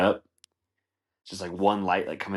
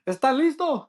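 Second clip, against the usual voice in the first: very high hype call in Spanish; rally the crowd in Spanish; hammed-up priest working the congregation; mock Spanish-speaking priest; 4 dB louder than usual. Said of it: "it's all in Spanish".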